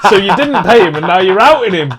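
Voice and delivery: laughing, high and loud, teasing; loud laughing tease at a mate; laughing accusation. Real, not acted. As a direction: crack up while calling him out.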